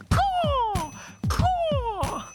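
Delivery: high cawing bird call; crow call. Retold or imitated.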